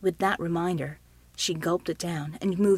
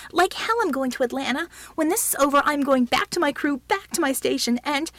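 higher, louder angry refusal; shouted protest; 6 semitones higher, 5 dB louder than usual. A narrator's reading. Healer refusing to leave her crew, shouting. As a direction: shout it down, heated and insistent. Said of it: "she shouted".

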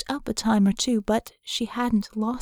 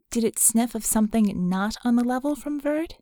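usual speaking voice

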